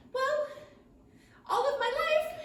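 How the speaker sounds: high, squeaky little dragonfly voice; tiny dragonfly voice. Acted.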